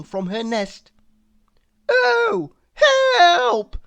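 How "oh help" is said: high, loud panicked worm cry; crying for help. A narrator's reading. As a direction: shriek for help like a terrified little worm. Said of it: "cried Walter".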